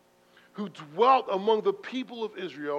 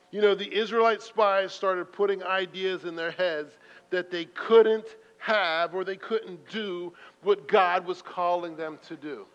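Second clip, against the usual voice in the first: ordinary talk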